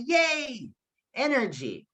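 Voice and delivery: match the friend's excitement; a friend answers the hockey team news with a bright cheer; energetic yay. Shown as a demonstration. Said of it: "trying to respond to the situation".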